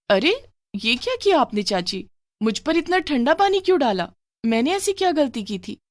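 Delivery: jolted awake by cold water, protest; startled protest. Acted. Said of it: "Rita चौंक कर उठ जाती है".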